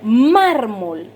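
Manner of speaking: "Mármol" with MÁR drawn out and hit; stress on penultimate syllable. Shown as a demonstration. Teacher demonstrating a grave word, "Mármol" stressed on MÁR. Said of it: "dónde se oye el golpe de voz".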